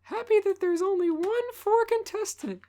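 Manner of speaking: put-on high girly voice; squeaky high girly voice; girly girl voice. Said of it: "make Dawn a girly girl".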